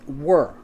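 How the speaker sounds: "were" said alone, low and firm; "were" isolated with a firm falling tone; stressed word. Shown as a demonstration. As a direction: set "were" apart and land on it. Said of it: "let us place emphasis on the word".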